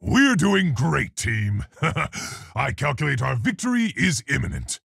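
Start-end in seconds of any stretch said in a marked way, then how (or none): 1.7-2.5 breathy chuckle. Acted. Gorilla laughs mid-battle.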